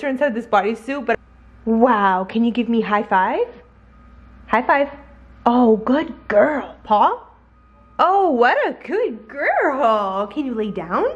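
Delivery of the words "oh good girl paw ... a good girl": slow, sing-song praise. Real, not acted. Owner praising her dog for a high five in sing-song baby talk. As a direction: reward the puppy's trick.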